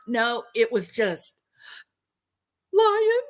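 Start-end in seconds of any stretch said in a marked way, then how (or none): 2.7-3.2 mock high-pitched, rising question; high questioning squeak. Storyteller mimicking the man's fateful word, mock squeaky question.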